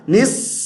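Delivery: نس ending in a long whistled s; whistling saad. Shown as a demonstration. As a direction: sustain the s of نس like a whistle. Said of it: "سیٹی کی آواز".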